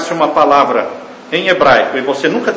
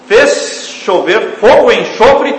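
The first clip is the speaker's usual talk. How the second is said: emphatic proclaiming =132; 6 semitones higher, 5 dB louder, slower than usual. Acted. Preacher stresses verse again.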